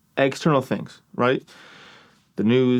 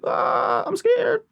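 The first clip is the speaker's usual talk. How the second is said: breathy gasp, then a squeaky high frightened cry; mock scared whimper; much slower than usual. Horror movie watcher imitated, whimpering scared. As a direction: play a viewer spooked by a slasher movie.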